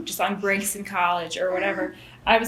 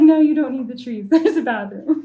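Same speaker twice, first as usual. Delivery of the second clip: laughing reply; 4 semitones higher, 5 dB louder than usual. Retold or imitated. Answering kids, laughing.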